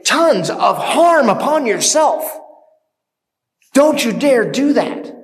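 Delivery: high, loud outraged warning; acted indignation. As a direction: rebuke them as if rushing in to stop it. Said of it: "who are so indignant".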